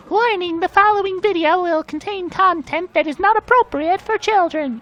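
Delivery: high, announcer-style funny voice; funny warning voice. Shown as a demonstration. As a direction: read the warning like a squeaky announcer. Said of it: "when I do those funny voices".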